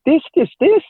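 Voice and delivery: high, loud exasperated rush of words; mock-exasperated outburst to his wife; exasperated listing. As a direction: throw up your hands and pile up the worries. Retelling his list of worries to wife.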